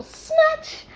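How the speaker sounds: high squealed snatch; sudden high yelp; dramatic snatch. Pretend. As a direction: shriek the word like a grab. Spirit snatching the baby.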